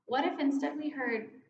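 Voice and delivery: usual speaking voice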